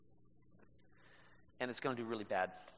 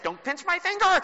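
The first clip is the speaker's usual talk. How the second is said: high, loud yelp of pain; mother yelping at the boy in a tug of war, high and loud; mother's yelp; 12 dB louder than usual. Retold or imitated. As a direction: cry out as the mom whose finger is pinched. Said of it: "and she's like".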